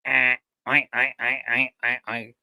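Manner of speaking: mock pig oinks thrown to the piggy bank; half-hearted oinking for the pig; lousy ventriloquist oinks. Bad ventriloquist trying to make the piggy bank talk, mock oinks. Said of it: "trying to throw my voice to the pig".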